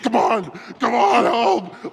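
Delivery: high-pitched urging on the run, acted out; retold frantic rallying cry; urgent mock shout. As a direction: holler it high, as if hauling someone along. Acts out urging friend while running.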